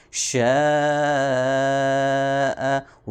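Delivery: شاء held for a very long aa; long madd muttasil; five-count madd. Shown as a demonstration. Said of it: "اس کو ہم نے پانچ second لمبا کرنا ہے۔".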